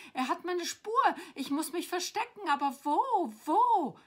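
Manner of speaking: high, loud, building panic; frantic storybook dragon looking for cover; horrified dragon. A narrator's reading. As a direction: build to a desperate cry. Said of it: "flüsterte er entsetzt".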